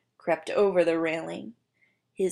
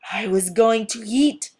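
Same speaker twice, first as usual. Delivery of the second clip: loud, slowing angry lizard; livid outburst; 2 semitones higher, 10 dB louder than usual. Acted. The livid lizard snarls at Martina. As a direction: let the fury slip out.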